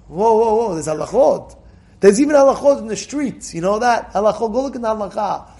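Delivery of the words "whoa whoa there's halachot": ordinary talk, nothing marked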